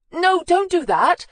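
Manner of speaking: horrified plea to stop; appalled. Acted. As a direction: cry it out in alarm. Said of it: "clearly appalled".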